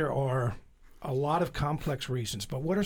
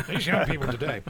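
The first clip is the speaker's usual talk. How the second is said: laughing; 4 dB louder, faster than usual. Real, not acted.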